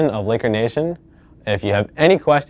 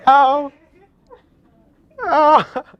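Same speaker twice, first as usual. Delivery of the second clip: stinging pain; much slower than usual. Real, not acted. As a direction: yelp as it stings. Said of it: "This stings".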